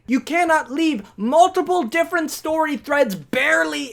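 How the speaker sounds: ordinary delivery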